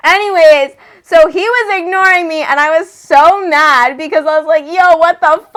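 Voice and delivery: loud, high-pitched indignant rant; worked-up anger. Retold or imitated. Telling how David ignored her, loud indignation.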